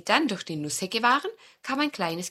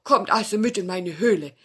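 louder, odd fox voice; welcoming fox; 6 dB louder than usual. Acted. Fox invites guests into cave, welcoming fox. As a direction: invite them in warmly.

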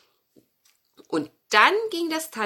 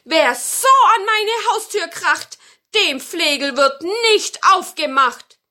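loud, raised grandmother voice; indignant call; 9 semitones higher, 9 dB louder than usual. Acted. Grandmother refuses the one banging on her door, loud high indignant call. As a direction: call it out firmly through the door. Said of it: "und rief".

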